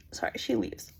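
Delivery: a quick whispered fix; whispered aside. Real, not acted. Correcting herself mid-story in a whisper.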